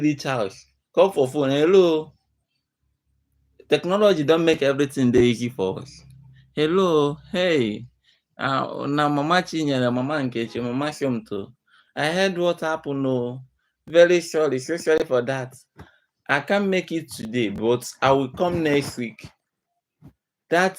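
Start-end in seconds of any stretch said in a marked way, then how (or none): 1.5-2.1 phone greeting. Acted. Phone call, answering. 6.5-19.3 sympathetic phone call. Acted.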